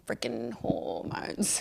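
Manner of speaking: breathy whisper, slow; hushed slow whisper; whispered aside. Real, not acted. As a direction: drop to a conspiratorial whisper.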